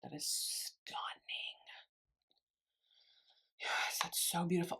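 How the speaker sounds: hushed whisper to herself, barely voiced. Real, not acted. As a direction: breathe it out as a private aside. Admiring the eye under her breath.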